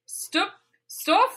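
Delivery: lilting mock-Swedish reading; Swedish Chef accent. Reading misspelled fan fiction to co-hosts, Swedish Chef accent. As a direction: read it like a bad PewDiePie Swedish impression. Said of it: "in the accent of Swedish Chef".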